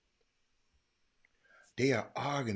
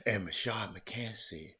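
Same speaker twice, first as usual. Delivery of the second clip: usual speaking voice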